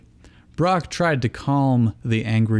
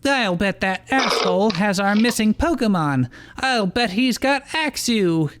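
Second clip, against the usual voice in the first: furious; 11 semitones higher than usual. Acted. Iris raging about missing Pokemon.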